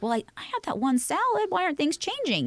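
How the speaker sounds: high, whiny complaining client voice. Pretend. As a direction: whine like someone baffled by no results. Mock client whining about salad.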